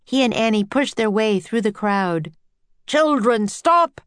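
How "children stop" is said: commanding shout rising in pitch. A narrator's reading. Professor ordering the children to stop, shouting. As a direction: call out sharply to halt them. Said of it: "the professor shouted".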